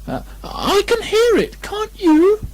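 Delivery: very high, anxious squeaky voice; a nervous little pig seeking comfort; high piping worry; Piglet anxiously. A narrator's reading. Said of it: "said Piglet anxiously".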